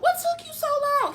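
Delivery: high, loud retold complaint; exasperated demand. Retold demand to late friend.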